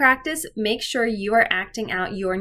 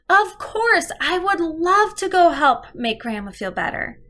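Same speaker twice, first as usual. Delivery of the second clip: put-on high, bright-eyed girl voice, louder; eager child; 8 semitones higher, 3 dB louder than usual. Shown as a demonstration. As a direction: chirp it like a keen little girl. Girl voiced in read story.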